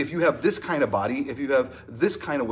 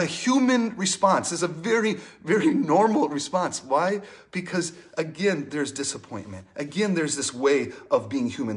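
ordinary talk

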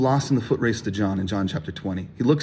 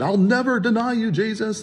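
high-pitched, overdone pledge of loyalty; hammed-up vow; 10 semitones higher than usual. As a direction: play an overeager disciple swearing loyalty, pitched way up. Acting Peter's vow to Jesus.

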